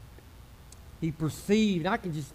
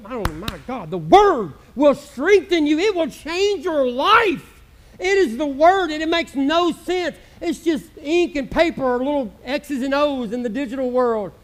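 louder, higher, rolling preacher cadence; full-force preaching; 4 semitones higher, 5 dB louder than usual. Acted. Pastor preaching to the church about the power of the Word, fervently. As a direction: preach it with fire.

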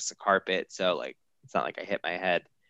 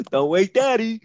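high, loud silly voice; hammed-up clowning, pitched way up; comedic show; 13 semitones higher, 6 dB louder than usual. Husband seen as joking on the floor, high clownish voice. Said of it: "comedic show at two thirty A M".

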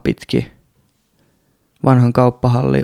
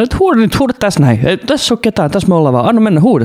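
much higher, eager urging; friend egging him on; 8 semitones higher than usual. Retold or imitated. Friend beside him urging him to just shout, high eager push. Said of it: "sit Jontta oli se mun vieressä".

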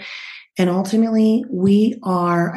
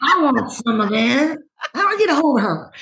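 high, laughing, eager voice; laughing excited imitation; mock eager fan; 7 semitones higher than usual. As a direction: play the hungry listener, laughing. Echoing the eager listener.

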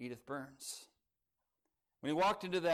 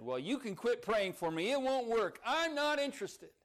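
put-on rising higher, dismissive old nurse; curt rebuff; 6 semitones higher than usual. A narrator's reading. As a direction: brush her off, a hint of the hard nurse. Hard nurse rebuffs Edith.

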